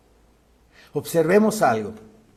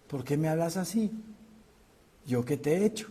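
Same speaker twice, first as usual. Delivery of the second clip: soft answer; 6 dB quieter, faster than usual. Acted. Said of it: "la blanda respuesta quita la ira".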